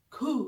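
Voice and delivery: खू ending in h; German Q. Shown as a demonstration. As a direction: let खू end with breath. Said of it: "इसके एंड में ह की आवाज आए". (खू के अंत में ह)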